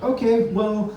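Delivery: chirpy airline clerk; airline agent voice. Retold or imitated. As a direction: mimic a chirpy gate agent. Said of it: "the lady goes like".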